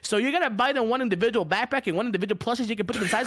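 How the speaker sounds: fast, breakneck merch pitch; rushed sales patter. Pretend. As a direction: race through the offer without pausing.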